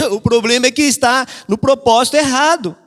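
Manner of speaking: ordinary delivery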